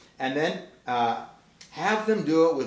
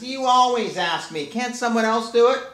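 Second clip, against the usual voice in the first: put-on high, louder whining child complaint; whiny cat kid; 8 semitones higher, 4 dB louder than usual. Cat kid complaining to mom about the trash, high whiny voice. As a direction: whine like a kid dodging chores. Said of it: "the cat says".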